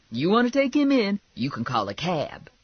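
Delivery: high, loud start dropping to a firm finish; fed-up Lula. Acted. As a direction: refuse flat out with attitude. Lula refusing a ride.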